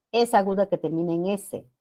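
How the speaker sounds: ordinary delivery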